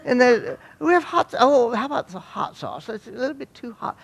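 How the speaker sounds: high-pitched mock British voice; hammed-up British chatter in a high register; British accent. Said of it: "we spoke British".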